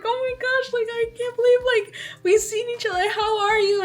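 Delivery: high, reenacted gushing; overwhelmed reunion. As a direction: gush in disbelief at seeing family. Acted reunion with aunt.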